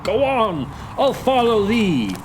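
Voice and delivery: high, urgent call; crying out. Acted. Hamlet calling to the beckoning spirit, crying out. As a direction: cry out, set on following. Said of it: "he cried".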